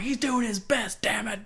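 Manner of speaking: high, exaggerated sarcastic defence; obnoxious fan mock-pleading; mock defending fan. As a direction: stick up for him with fake outrage. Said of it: "I'm like".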